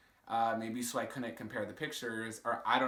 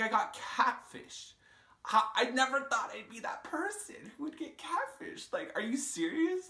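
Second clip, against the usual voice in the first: hammed-up self-pity; mock disbelief; 12 semitones higher than usual. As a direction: drag it out like you can't believe it. Disbelief at being catfished.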